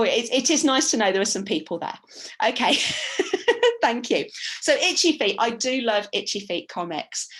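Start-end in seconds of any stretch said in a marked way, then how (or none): 2.8-3.8 high, happy burst of laughter; real laugh. Real, not acted. Teacher laughing as the audience responds, high real laugh. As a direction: laugh with relief.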